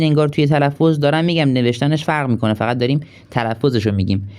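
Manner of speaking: ordinary talk, nothing marked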